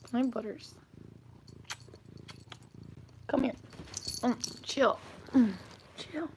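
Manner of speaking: very soft, private cooing; tender pet murmur. Real, not acted. Owner settling the cat to chill, tender murmur. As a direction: murmur lovingly to a pet close by.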